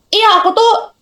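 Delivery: high chatty influencer voice; lightly voiced girly vlogger chatting; mock beauty vlogger. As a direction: play a chatty makeup seller. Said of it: "dosen cantik kan misalnya".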